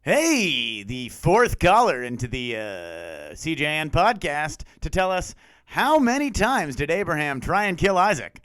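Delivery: high, slow contest announcer; hammed-up call-in contest host; radio voice. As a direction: draw it out like a hyped-up radio DJ. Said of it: "let's do it in a radio voice".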